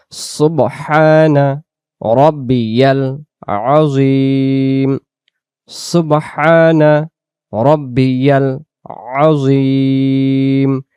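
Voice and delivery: very slow "সুবহানা রব্বিয়াল আযীম", each word drawn out; slow correct recitation. Shown as a demonstration. Teacher modeling the correct ruku tasbih. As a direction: chant "সুবহানা রব্বিয়াল আযীম" slowly and fully. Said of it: "এভাবে করে বলতে হবে".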